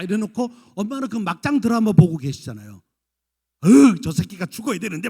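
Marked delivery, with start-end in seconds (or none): 3.6-5.1 loud, acted outburst at a drama villain; seething at the TV. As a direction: groan and curse, hammed-up and loud. 드라마 보며 분통 터뜨림. (드라마 보며 분통 터뜨리듯)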